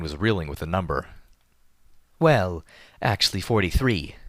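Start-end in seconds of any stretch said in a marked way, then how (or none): 2.2-4.2 lightly whimsical correction; a playful, offhand touch. A narrator's reading. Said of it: "she said whimsically".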